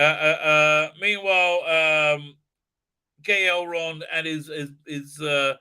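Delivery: usual speaking voice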